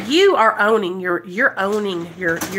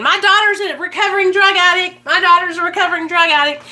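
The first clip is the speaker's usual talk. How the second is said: proud mom; 8 semitones higher, 4 dB louder, faster than usual. Retold or imitated. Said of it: "should be doing backflips saying".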